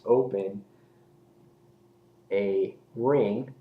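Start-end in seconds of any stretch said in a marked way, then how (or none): none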